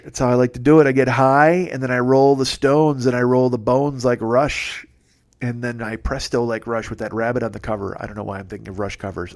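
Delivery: normal talking voice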